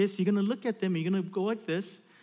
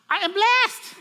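put-on high, loud little-kid squeal; four-year-old girl; 9 dB louder, slower than usual. Little daughter declares it to dad, high loud child voice. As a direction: imitate a tiny child proclaiming. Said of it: "four years old, she goes".

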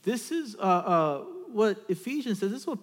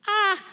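short, high "ah"; happy child's gasp; 7 dB louder than usual. Retold or imitated. Little daughter gets so happy, short high cry. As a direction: imitate a delighted little kid's cry. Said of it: "She gets so happy".

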